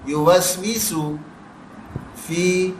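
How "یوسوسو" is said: یوسوسو slow, ending in a pure i; correct pure kasra. Shown as a demonstration. Teacher correcting the kasra, slow careful yuwaswisu. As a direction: say یوسوسو slowly, final vowel a clean i, not e. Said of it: "یوسوسو نہیں ہے".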